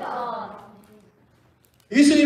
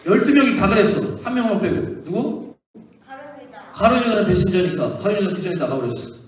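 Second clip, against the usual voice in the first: ordinary talk, nothing marked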